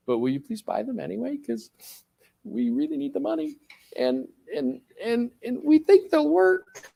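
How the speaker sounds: hesitant, hammed-up begging; mock pleading. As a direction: beg the customer in a small high voice. Acted manufacturer pleading with customer.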